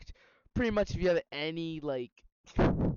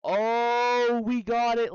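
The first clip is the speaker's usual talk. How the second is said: loud, high, stretched-out Oh; excited cheer; 7 semitones higher, 7 dB louder, slower than usual. Real, not acted. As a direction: burst out in triumph, voice raised. Cheering at finding the stages list.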